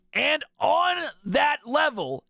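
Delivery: slow and loud, hyping the detail; excited. Real, not acted. Gamer hyping the game's depth to viewers, excited.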